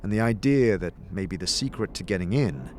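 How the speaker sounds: soft, hushed scene narration; game master narration. A narrator's reading. Game master describing the tower to the players, hushed. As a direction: draw them toward the tower.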